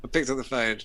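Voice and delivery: ordinary delivery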